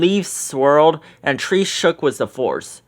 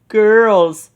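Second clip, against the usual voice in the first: high, loud delighted call; light up at the sight; Shaggy calling out; excited exclaim; 6 semitones higher, 6 dB louder than usual. A narrator's reading. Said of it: "Shaggy exclaimed".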